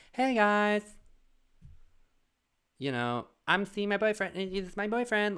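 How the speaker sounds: high, bouncy mock announcement; chirpy announcement. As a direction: announce it sing-song and bright. Imagined announcement by the guy.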